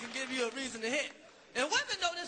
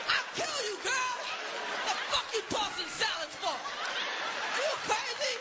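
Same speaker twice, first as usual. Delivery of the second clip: furious mock yelling; 5 semitones higher than usual. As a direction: scream at her like you've lost it, half laughing. Acted man shaking yelling woman.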